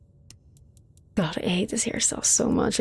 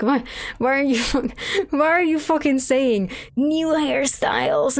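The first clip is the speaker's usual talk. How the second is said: exasperated rant; 6 semitones higher than usual. Real, not acted. Ranting at the game company.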